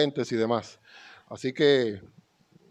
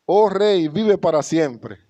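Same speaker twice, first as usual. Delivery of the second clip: louder, slow, solemn greeting to the king; royal greeting; 4 semitones higher, 6 dB louder, slower than usual. Acted. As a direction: hail the king with full formality. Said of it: "este era el saludo real". (saludo solemne al rey, más fuerte y lento)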